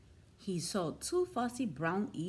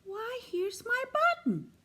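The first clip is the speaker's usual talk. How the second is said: much higher, delighted exclamation; excited cry; 11 semitones higher than usual. A narrator's reading. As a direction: cry out in joy at finding it. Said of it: "he cried".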